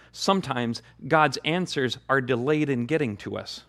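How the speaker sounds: ordinary talk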